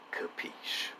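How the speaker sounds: breathy whispered question; secretive whisper. Acted. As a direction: lean in and whisper it. Hushed conspiratorial questioning.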